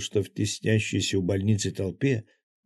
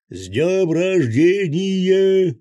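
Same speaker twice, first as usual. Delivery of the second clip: chorus shout; 11 semitones higher, 9 dB louder, much slower than usual. A narrator's reading. Chorus over the radio.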